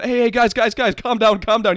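loud mock pleading to calm down; dad calming them. Dad trying to calm the angry parents, loud pleading. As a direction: raise your voice to settle the crowd. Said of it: "My dad's over there going".

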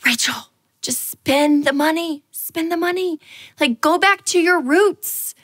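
high, slow, coaxing self-talk; inner pep talk. Retold or imitated. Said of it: "I remember thinking".

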